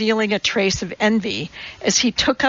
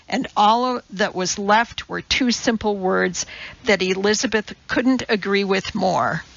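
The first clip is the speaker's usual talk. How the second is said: ordinary delivery, nothing marked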